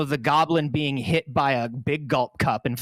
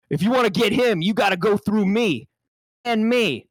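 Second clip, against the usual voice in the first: higher mock tough guy, laughing in; hammed-up tough line; 6 semitones higher than usual. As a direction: take two of the tough line. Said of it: "we're gonna go for another take".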